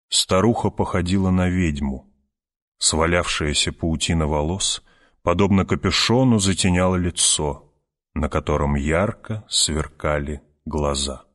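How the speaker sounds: ordinary delivery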